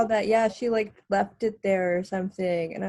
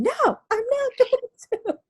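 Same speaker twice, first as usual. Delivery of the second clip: much higher, squeaky defiant reply; Vicky refusing to calm down, squeaky and defiant. Acted.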